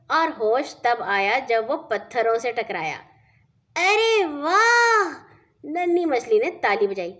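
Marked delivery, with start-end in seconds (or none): 3.8-5.1 delighted cheer. Acted. Fish cheers the chick's flight.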